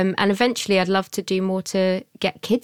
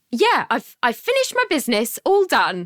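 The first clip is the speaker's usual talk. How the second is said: high, fake bright yes; mock cheerful; 7 semitones higher than usual. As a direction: play it falsely sunny. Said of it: "I look at him sarcastically like".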